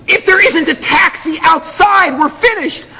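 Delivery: slow, high-pitched frantic yell. Retold or imitated. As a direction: yell it down the stairwell. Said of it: "she was yelling at me".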